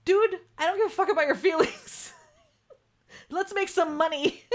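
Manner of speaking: high, loud, laughing through the line; laughing mock retort. Laughing at a friend's honest pricing, mock blunt retort. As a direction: blurt it out while cracking up. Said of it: "And it's like".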